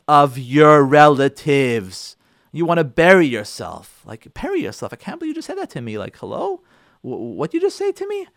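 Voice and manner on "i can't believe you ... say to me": high, quick, softer mock outrage; shocked wife. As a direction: gasp out the insult like a stung wife.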